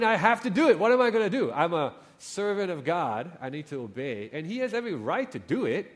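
normal talking voice

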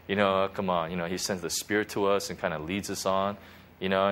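normal talking voice

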